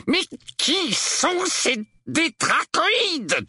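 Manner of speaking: slow, high-pitched furious question. A narrator's reading. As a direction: demand it with drawn-out fury. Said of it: "demanda l'oncle Vernon avec fureur". (question furieuse, aiguë et traînante)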